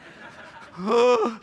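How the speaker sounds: sudden high laugh breaking out; high burst of laughter. Real, not acted. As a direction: laugh as the memory hits. Overcome, laughing at own story.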